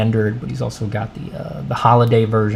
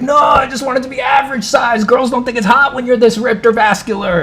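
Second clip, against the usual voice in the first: whiny protest; 12 semitones higher than usual. Retold or imitated. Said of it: "he's like".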